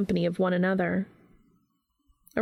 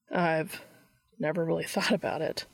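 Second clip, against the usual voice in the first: quiet, half-laughed admission with little humor; soft humorless chuckle; 3 semitones lower, 4 dB quieter than usual. A narrator's reading. Dean admitting it to Castiel, with a soft, humorless chuckle. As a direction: let a faint, mirthless chuckle into it. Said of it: "chuckling in a soft and humorless way".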